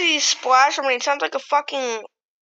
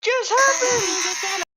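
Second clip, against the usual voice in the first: much higher, very slow, half-crying disbelief; shocked disbelief; 13 semitones higher, much slower than usual. Real, not acted. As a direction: stretch it out in stunned shock. Shocked reaction to the video.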